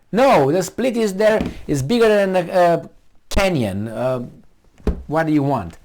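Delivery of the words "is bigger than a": ordinary talk, nothing marked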